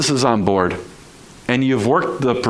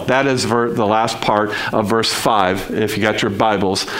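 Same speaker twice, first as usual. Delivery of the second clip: usual speaking voice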